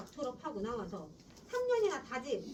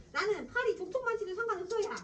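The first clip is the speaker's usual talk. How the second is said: put-on higher, louder old man in dialect; gruff grandfather; 9 semitones higher, 4 dB louder than usual. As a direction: wave it off like a crude old man. Grandfather quote read in dialect.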